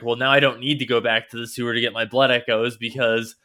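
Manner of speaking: ordinary delivery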